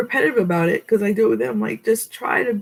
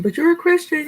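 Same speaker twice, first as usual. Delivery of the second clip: much higher-pitched challenge; mock objecting viewer; 11 semitones higher than usual. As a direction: put on a high voice for the objector.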